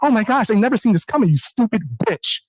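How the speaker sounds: usual speaking voice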